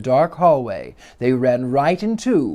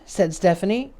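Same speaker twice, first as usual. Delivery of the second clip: plain reading voice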